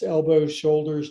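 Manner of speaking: normal talking voice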